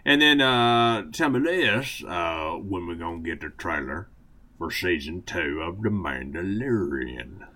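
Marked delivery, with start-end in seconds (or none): 1.1-7.4 put-on Southern accent. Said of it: "Southern accent segment".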